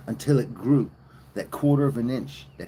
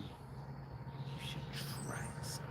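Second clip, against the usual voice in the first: breathy whispered aside, almost no voice; 20 dB quieter than usual. Acted. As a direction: lean in and whisper the tip. Whispered aside after loud talk.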